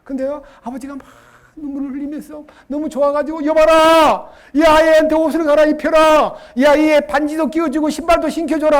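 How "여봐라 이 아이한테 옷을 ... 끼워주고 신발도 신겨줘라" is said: loud proclamation to the servants; joyful father commanding his servants; commanding orders. Pretend. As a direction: order them about, overjoyed. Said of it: "명령을 내린 다음에".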